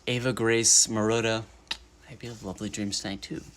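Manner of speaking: ordinary delivery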